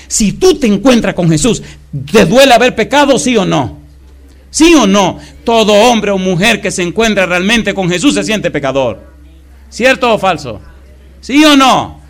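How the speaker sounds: loud, high preacher's cry; full-force sermon shouting; fiery preaching. Acted. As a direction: rouse the congregation, shouting.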